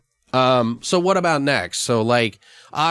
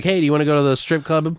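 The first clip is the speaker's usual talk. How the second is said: quick, lightly voiced invitation, a bit higher; playful invite; 6 semitones higher, faster than usual. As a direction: tease him into going out. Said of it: "Maybe he's like".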